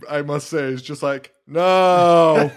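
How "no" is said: a flat, half-hearted mock yell of no. [name] watching the mower, a half-hearted mock yell. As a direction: toss off a weak, unconvinced no. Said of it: "half-heartedly".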